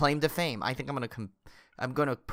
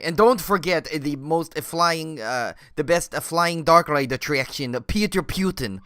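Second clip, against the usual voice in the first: halting Russian tour pitch; hammed-up Russian salesman; mock Russian accent; 3 dB louder than usual. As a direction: tout it with a fake Russian accent. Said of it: "turned off our entire Russian audience".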